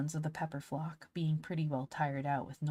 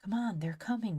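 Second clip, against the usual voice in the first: plain reading voice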